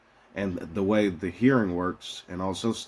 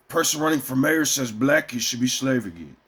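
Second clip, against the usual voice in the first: normal talking voice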